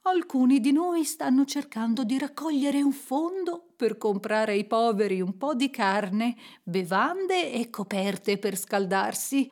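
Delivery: courteous appeal in a put-on voice; polite charity collector. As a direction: make a gentle, formal pitch for donations. (questuante cortese e garbato)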